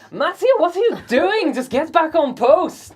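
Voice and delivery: loud, high-pitched scolding; exasperated shout. Acted. Guard follows Matea out the door, exasperated shout. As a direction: call after them, fed up and insistent.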